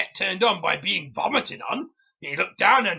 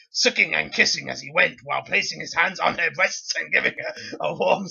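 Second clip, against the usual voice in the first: ordinary delivery, nothing marked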